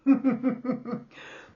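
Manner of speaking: high chuckle at oneself; self-amused laugh. Real, not acted. Host joking about failed predictions, amused laugh. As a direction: laugh off your own silly act.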